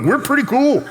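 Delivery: a dad's hammed-up swagger; mock cool bravado. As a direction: act like the coolest guy alive. Retold answer to daughter.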